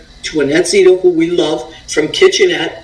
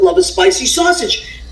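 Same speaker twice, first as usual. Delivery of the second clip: lightly mimicked pitchman, higher; a hint of a cheesy ad pitch, higher; Mario's ad line; 11 semitones higher than usual. Host quoting Mario's commercial slogan, high mock pitchman. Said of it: "that's his line".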